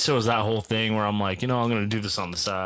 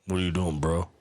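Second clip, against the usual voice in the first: bro voice; 6 semitones lower, 4 dB quieter than usual. Acted. Acting out bro talk.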